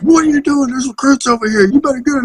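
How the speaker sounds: mock sergeant yell. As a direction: imitate the sergeant tearing into him. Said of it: "start going off on me".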